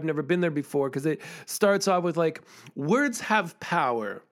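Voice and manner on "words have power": slow, weighty "Words have power"; dramatic slam opener; slow poem opening. Shown as a demonstration. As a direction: land "Words have power" slowly and let it hang. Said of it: "And I leave a pause".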